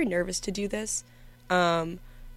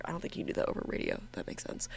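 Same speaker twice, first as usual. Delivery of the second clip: hushed, breathy aside; whispered aside; 2 semitones lower, 8 dB quieter, much faster than usual. Real, not acted. Aside under her breath.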